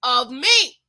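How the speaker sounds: slow, loud, drawn-out scripture line; loud, stretched "Learn of me"; quoting Jesus. Retold or imitated. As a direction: proclaim it slowly like scripture. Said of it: "even Jesus says".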